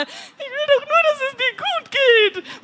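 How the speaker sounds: high, slow pleading call; desperate cry. A narrator's reading. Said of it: "ruft er verzweifelt". (verzweifelt gerufen)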